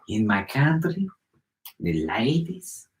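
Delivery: put-on slow, deliberate Mexican-accented English; drawn-out mock Mexican accent; imitated Mexican accent. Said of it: "that has that same exact accent".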